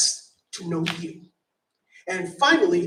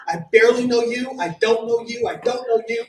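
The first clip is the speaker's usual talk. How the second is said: normal talking voice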